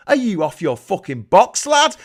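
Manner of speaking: normal talking voice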